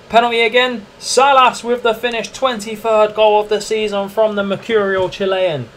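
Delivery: commentator voice. Acted. Gamer narrating his own goal like a broadcaster. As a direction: call it like a TV match commentator.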